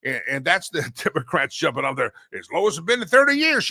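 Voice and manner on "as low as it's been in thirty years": higher, louder mocking quote of their boast. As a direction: parrot the boast back with scorn.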